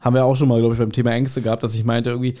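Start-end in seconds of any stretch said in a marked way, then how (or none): none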